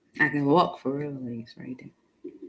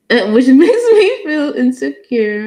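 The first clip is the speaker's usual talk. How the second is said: laughing; 7 semitones higher, 14 dB louder than usual. Real, not acted. Laughs while saying it.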